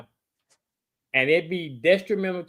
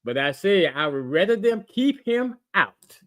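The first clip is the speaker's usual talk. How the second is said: each word set apart; re-explain it carefully, phrase by phrase; slower, deliberate; 3 semitones lower than usual. Real, not acted. Restating his point about the injured player, slow and deliberate. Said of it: "let me say it a little bit slower".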